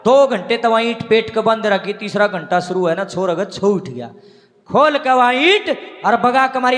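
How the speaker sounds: ordinary delivery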